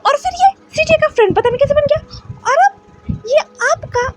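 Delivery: a scolded son sobbing his protest; hurt, crying voice pitched high; tearful complaint. Acted.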